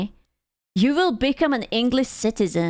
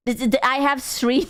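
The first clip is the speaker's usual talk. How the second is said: normal talking voice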